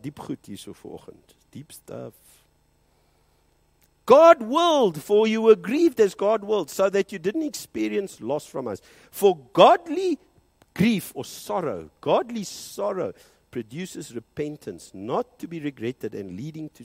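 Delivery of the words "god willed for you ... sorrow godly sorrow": loud, high preaching, every line driven home; full-force preaching. Acted. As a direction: preach it out to the whole hall.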